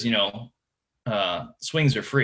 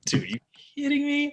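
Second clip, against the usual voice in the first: high squeal of disbelief. Retold or imitated. To his co after a three pounder, a high stunned squeal. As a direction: shriek it like you can't believe it. Co smokes another big fish.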